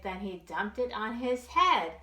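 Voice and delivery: plain reading voice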